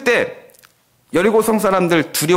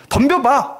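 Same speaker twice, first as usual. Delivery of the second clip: taunting; 8 semitones higher, slower than usual. Retold or imitated. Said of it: "조롱하고 그럴 것 같습니다".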